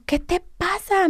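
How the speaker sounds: mock yell, pitched high; imitating a shouting official; retold yell. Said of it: "Me empezó a gritar horrible así". (grito imitado)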